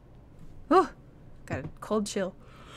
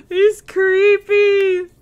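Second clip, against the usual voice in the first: higher, louder, very slow; shaky aside; 9 semitones higher, 9 dB louder, much slower than usual. Real, not acted. Streamer spooked by a creepy man, drawling. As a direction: drag it out spooked. After fright.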